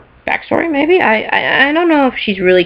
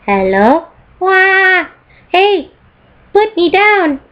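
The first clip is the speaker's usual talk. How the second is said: turret voice; 10 semitones higher, much slower than usual. Pretend. Voicing the toy turret.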